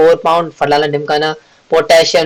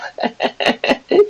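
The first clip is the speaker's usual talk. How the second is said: soft, high chuckle; a quiet run of giggles; 13 semitones higher, 5 dB quieter than usual. Real, not acted. Teacher amused after saying he loves Punjab, chuckling. Laughs after his own joke.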